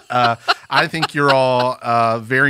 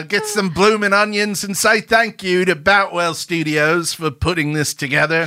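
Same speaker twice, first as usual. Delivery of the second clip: high, hammed-up Aussie ad voice; put-on cheery Aussie accent; mock Australian accent; 6 semitones higher than usual. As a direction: read thanks like an Australian steakhouse commercial. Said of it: "as good as an Outback Steakhouse commercial".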